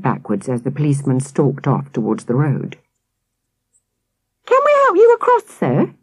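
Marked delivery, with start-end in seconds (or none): 4.4-5.9 very high and fast cheeky call; shouted. Acted. Twins calling cheekily after the departing policeman. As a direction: yell it after him, cheeky. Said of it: "the twins shouted".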